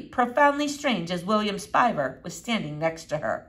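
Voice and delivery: ordinary delivery, nothing marked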